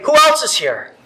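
mock careless shout. Preacher mocks what God didn't say, loud and offhand. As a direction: bark it out as if God doesn't know her. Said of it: "They didn't just say".

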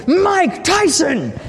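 shouted name. Retold or imitated. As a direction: yell it with arms up, thrilled. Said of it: "I put my hands up in the air and I shouted".

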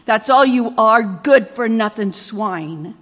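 slow, loud roaring insult; furious shout. Acted. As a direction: yell it after her with contempt. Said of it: "his shout polluted the air".

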